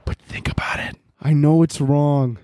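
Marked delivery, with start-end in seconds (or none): 0.1-1.0 whisper. Acted. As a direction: whisper it like a secret plan. Conspiratorial aside, unvoiced.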